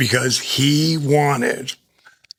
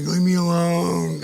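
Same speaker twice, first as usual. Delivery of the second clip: imitated annoyed wife; 6 semitones higher than usual. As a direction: slowly moan it like someone woken up. Woken wife brushing him off.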